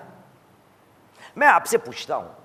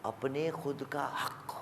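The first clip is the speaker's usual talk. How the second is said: much lower and far softer; the quiet second take after the booming one; hushed echo; 6 semitones lower, 16 dB quieter than usual. Acted. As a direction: let it sink in almost under the breath. Preacher repeats phrase, loud then hushed.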